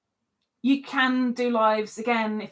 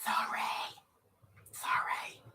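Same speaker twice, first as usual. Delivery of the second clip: whisper; 13 dB quieter than usual. Real, not acted. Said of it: "whispered sorry".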